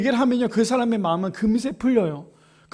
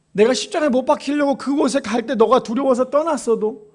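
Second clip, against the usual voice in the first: usual speaking voice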